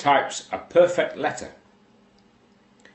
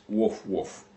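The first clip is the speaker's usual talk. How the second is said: two short mock barks, woof woof; dog bark imitation; 2 semitones lower, 6 dB quieter than usual. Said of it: "and barks twice".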